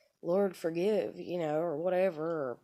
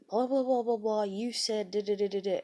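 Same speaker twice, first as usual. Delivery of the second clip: higher-pitched mock nagging blah-blah; mocking rant; 4 semitones higher than usual. Woman retells husband dragging up her old words, mocking rant. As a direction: imitate him rattling off accusations.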